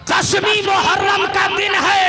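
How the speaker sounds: high, ringing sermon shout; full-force preaching. Acted. Preacher announcing the day of Muharram, full-force preaching. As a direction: cry it out to the congregation.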